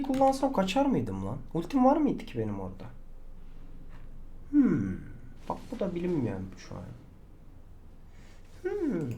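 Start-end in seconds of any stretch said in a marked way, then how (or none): none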